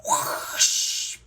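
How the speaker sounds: loud, breath-only whoosh; imitated rush of water; whooshing splash noise. Storyteller imitates Daddy Pig diving in.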